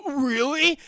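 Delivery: high, loud eager gasp of a question; imitating an eager guy jumping at the offer; excited really. Frat guy offered a treat.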